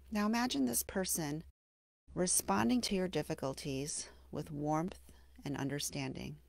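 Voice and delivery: gentle meditation guide with long, settling pauses; guided meditation. Acted. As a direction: ease the listener into self-compassion, unhurried and hushed at the phrase ends. Leads a mindfulness meditation.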